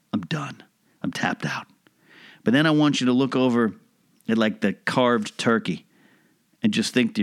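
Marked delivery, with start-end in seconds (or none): 0.1-1.7 fading to a defeated whisper; drained, barely getting the words out; exhausted. Retold or imitated. Overstuffed diner at Thanksgiving giving up, whispered defeat. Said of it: "you're gonna be like".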